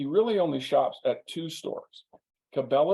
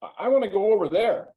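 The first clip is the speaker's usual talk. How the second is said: eager guest; 7 semitones higher, 5 dB louder, faster than usual. Retold or imitated. He points up and says.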